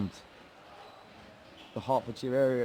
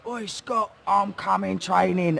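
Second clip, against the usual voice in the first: put-on higher, slow drunken shout across the street; slurred, loud, slow pub-night promise; drunk call out; 8 semitones higher, 4 dB louder, slower than usual. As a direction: call out to Scott like a drunk lad. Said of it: "gone up to him drunk saying".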